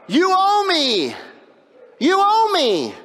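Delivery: high, loud, slow furious demand; snarling you owe me at a cowering man; angry demand. Acted. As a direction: act out the servant choking his debtor in rage. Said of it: "Does that sound like anger?".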